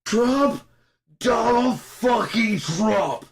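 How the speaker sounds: high, drawn-out screamed rant; screaming. Real, not acted. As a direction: scream each word long and high. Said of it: "I'm just screaming here".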